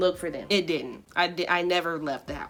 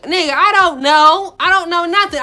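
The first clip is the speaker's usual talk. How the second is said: loud, high mock wail; hammed-up crying shout, pitched way up; crying protest; 8 semitones higher, 10 dB louder than usual. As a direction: act out a tearful, panicked outburst. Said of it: "I'm crying. I'm like".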